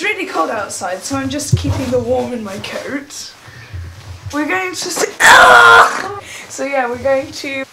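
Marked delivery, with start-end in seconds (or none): 4.3-6.5 bursts out loud then laughs; excited shout. Real, not acted. Kid shouting in the car.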